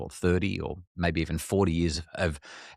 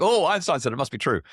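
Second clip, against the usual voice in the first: gullible listener; 7 semitones higher than usual. Retold or imitated. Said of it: "They're like".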